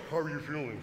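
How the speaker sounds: low, altered voice as if through a breathing mask; mock voice from inside the mask. Man in sleep mask answers shocked date, masked voice.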